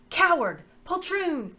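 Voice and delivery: high, very loud mouse squeal; furious squeak. Acted. Reepicheep defies the slavers, with a loud furious squeak. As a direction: spit the insults like an outraged mouse. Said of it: "squeaked Reepicheep".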